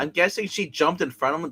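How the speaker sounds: ordinary talk, nothing marked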